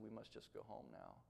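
low, very soft voice; subdued retold quote. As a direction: say it quietly, as a shaken preacher giving up. Retold words of shaken preacher.

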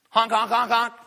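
loud high honking imitation; goose honks. Preacher imitates geese honking.

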